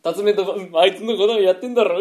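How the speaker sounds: ordinary talk, nothing marked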